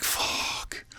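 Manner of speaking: hushed whispered curse, dreading what's next. Retold or imitated. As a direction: breathe the word out in dread. Alone on bunk, fearing charges.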